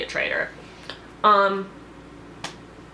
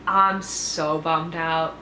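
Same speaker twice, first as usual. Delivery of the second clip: bummed out, drawn out; much slower than usual. Real, not acted.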